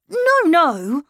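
put-on a boy insisting he's grown. A narrator's reading. Miles telling his governess he's growing up.

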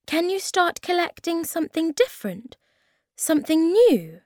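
high, lifted, hopeful questions; rising excitement over a new idea; eager suggestion. A narrator's reading. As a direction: pitch the idea brightly and high. Character eagerly offers an idea.

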